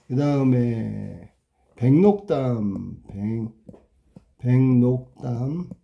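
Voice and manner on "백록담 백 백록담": slow dictation. Shown as a demonstration. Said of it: "일단 적어보는 거야".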